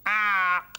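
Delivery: loud bird-like caw; short loud raven squawk; thoughtful raven. A narrator's reading. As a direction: croak it out as if pondering. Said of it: "said Mortimer thoughtfully".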